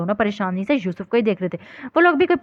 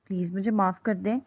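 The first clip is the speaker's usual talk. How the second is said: ashamed plea; 2 semitones lower, 6 dB quieter than usual. Acted. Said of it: "ان کی شرمندگی ان کے چہرے سے واضح تھی".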